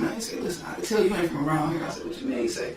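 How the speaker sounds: ordinary talk, nothing marked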